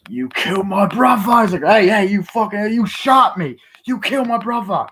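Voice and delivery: high, loud, outraged accusing; furious high yell. Acted. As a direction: scream the accusation at him. Enraged confrontation over brother.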